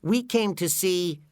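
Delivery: high cartoon voice; high-pitched character voice, building to an announcement; SpongeBob. A narrator's reading. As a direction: say it high, leading up to the big reveal. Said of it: "SpongeBob was disappointed".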